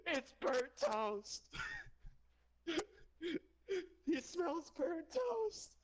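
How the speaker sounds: high, drawn-out yell through laughter; joking yell. Pretend. Friends joking about a stroke, high laughing yell. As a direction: yell it high and stretched, cracking up.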